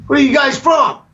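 high, barked cop question; mock cop shout. State trooper questioning the Aussie boys, high barking shout. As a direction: bark it like a suspicious cop.

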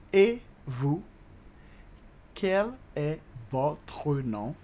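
"Et vous? Quel est votre nom?" very slow, word by word; slow teaching demo. Shown as a demonstration. Teacher repeating the French question for learners, "Et vous? Quel est votre nom?" very slowly.

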